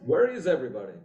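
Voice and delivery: lightly imitated Italian accent. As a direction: quote it with a hint of an Italian accent. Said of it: "he said that in an Italian accent".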